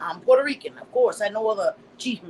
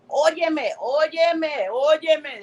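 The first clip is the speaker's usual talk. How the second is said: higher Spanish "óyeme" with each one falling; put-on Spanish call; 6 semitones higher, much slower than usual. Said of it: "y'all want me to say".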